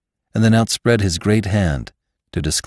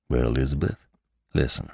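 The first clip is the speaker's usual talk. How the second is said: low gentle cowboy; 6 semitones lower, 6 dB quieter, faster than usual. Acted. Lassiter, low and soft.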